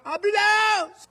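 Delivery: long, high shouted cry; frantic call for help. Retold or imitated. Panicked mother calling from her porch for an ambulance, drawn-out shout. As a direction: holler it out from the porch.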